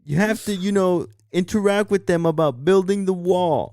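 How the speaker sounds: Trump. Acted. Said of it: "like fucking Trump right now".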